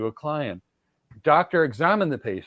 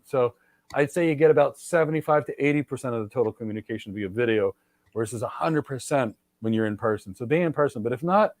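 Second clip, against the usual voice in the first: normal talking voice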